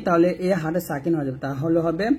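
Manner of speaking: normal talking voice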